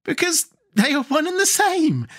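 high-pitched, merry declaration. A narrator's reading. Girl reveals her joke to the king, merrily. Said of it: "now laughing merrily".

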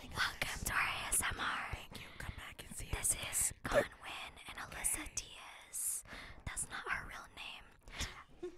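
hushed, breath-only show intro; mock ASMR whisper. Hosts welcome listeners to a pretend ASMR show, whispering. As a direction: whisper softly and slowly to the listeners. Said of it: "about this whispering".

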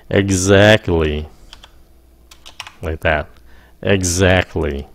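Exactly said slowly, every sound clear, a buzzing z in the middle; slow careful pronunciation. Shown as a demonstration. Teacher modeling Exactly slowly for learners. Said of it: "exactly phát âm như sao?".

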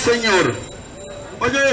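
normal talking voice

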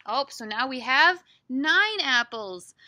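ordinary delivery

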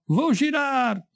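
high, drawn-out stop call; conductor's shout. A narrator's reading. Conductor announcing the stop to passengers, shouted. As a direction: call the stop out loudly, stretching it. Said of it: "Il conducente gridò".

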